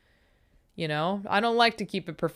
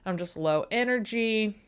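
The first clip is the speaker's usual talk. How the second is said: ordinary delivery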